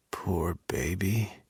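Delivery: soft, slow, tender whisper; a lover's tender, almost mocking whisper. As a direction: whisper it with care, half teasing. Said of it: "the words were full of tenderness".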